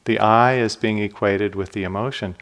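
ordinary talk, nothing marked

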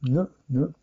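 a hummed "n, n"; n sound. Shown as a demonstration. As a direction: hold the tongue on the ridge for "n". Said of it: "Then you have the N".

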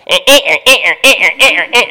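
loud, harsh repeated bird squawk; imitated francolin call. Teacher mimicking the horrible bird call for students. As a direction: blast out the ugly bird call. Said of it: "The francolin goes".

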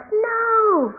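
one long, high mock yell; worked-up yell. Cartoon boy running around in a frenzy, high mock yell. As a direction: cry it out frantically, hammed up. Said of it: "he's getting really worked up".

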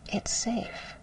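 hushed, relieved whisper; soft whisper. Retold or imitated. Quoting a calmed student, whispered. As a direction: breathe it out in relief. Client finding peace.

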